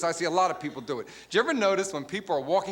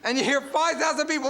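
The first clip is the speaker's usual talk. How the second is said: normal talking voice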